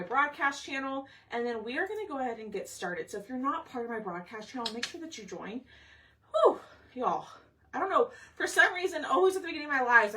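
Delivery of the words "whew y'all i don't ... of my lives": breathless, winded chatter; out of breath. Real, not acted. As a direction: talk while still catching your breath. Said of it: "I'm, like, out of breath".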